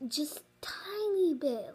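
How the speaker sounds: ordinary delivery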